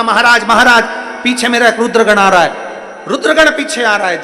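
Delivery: high, loud, urgent cry for help; panicked plea. Acted. Fleeing Rahu warns Jalandhar of his pursuer, in panic. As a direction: blurt it out as if being chased.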